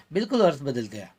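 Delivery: ordinary delivery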